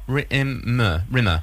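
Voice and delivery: normal talking voice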